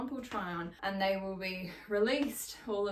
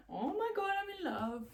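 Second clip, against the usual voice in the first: excited gushing; 12 semitones higher than usual. Real, not acted. Gushing over her new leggings.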